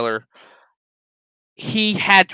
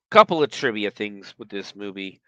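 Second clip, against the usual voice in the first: ordinary delivery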